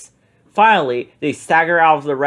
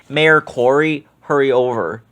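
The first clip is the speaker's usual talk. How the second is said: ordinary delivery, nothing marked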